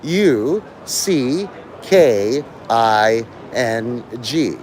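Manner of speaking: each letter drawn out, very slow; slow spelling. Shown as a demonstration. Streamer spells a word for a kid in chat, very slow and deliberate. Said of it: "I'll spell it out for you".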